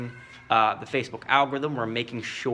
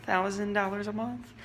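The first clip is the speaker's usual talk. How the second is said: softer, higher unsure question; timid price; 5 semitones higher, 7 dB quieter than usual. Retold or imitated. Salesperson naming price to client, timid. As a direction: ask the price like you doubt it. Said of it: "I'll be like".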